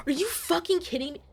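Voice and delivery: can't believe this verdict; outraged disbelief. Real, not acted. Outraged at the ruling.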